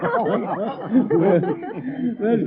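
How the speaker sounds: slow, chuckling through the line; amused laugh. Acted. Old actor hears Family Hold Back for the first time, chuckling. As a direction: let the laugh carry the words.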